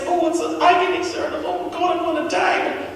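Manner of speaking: high, loud hammed-up death throes; faked dying agony. Pupil fakes dying pain to teacher, overacted. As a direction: wail like you're dying to fool sir. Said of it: "Hold on, I'm only acting".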